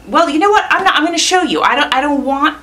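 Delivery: bright, high and loud burst of eagerness; excited. Real, not acted. Vlogger teasing her unboxing to viewers, high and excited. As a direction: jump in keen to show, not tell.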